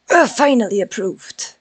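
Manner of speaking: happy relieved cheer; excited. Real, not acted. Client approves work in game.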